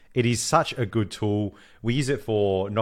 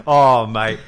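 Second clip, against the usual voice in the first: normal talking voice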